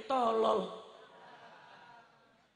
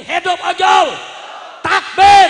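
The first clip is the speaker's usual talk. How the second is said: high, full-force cheer and takbir; shouted rallying cry; 10 semitones higher, 23 dB louder, slower than usual. Real, not acted. Preacher rallying the other side of the congregation, shouting. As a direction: lead the crowd in a loud chant.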